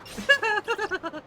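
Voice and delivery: high burst of real laughter; embarrassed laugh after ranting. Real, not acted. Laughs at own rant.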